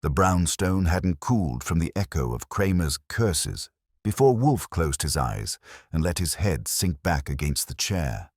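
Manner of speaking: ordinary delivery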